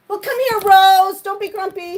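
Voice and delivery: high, sing-song coaxing; cooing at her pet Rose; coaxing pet talk. Real, not acted.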